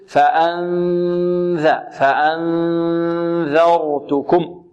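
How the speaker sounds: nasal hum before the ذال in فأنذرتكم, tongue tip at the teeth; slow recitation demo. Shown as a demonstration. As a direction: draw out the hidden نون in فأنذرتكم before the ذال. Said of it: "الذال نقول".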